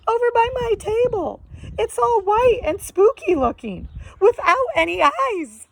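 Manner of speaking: high shaky warning; frightened old woman. Acted. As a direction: describe the monster in panic.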